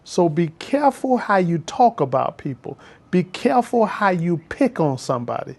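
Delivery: normal talking voice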